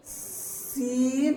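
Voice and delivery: سین with a long whistling hiss on the s, drawn out slowly; سین said slowly with a sharp sibilant hiss; hissing seen. Shown as a demonstration. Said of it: "اور یہ شین".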